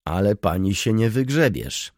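usual speaking voice

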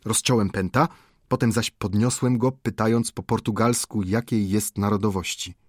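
ordinary delivery, nothing marked

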